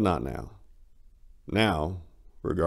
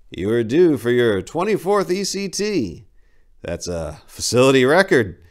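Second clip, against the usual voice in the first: much higher and louder, smug and prim; condescending doctor; 7 semitones higher, 7 dB louder than usual. Acted. Doctor boasting to patient about his record, smug. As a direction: announce it like an achievement.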